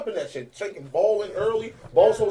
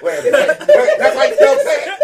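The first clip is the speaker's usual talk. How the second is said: high, loud, slowed panic; panicked; 12 semitones higher, 10 dB louder, slower than usual. Retold or imitated. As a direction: freak out over spelling cat. Retold panic in college.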